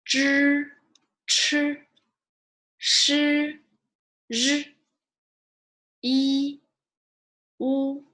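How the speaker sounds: teacher drilling pinyin aloud; Mandarin initials drill. Shown as a demonstration. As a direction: model Zi like the end of needs, Ci like students. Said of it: "we'll say it one more time from here".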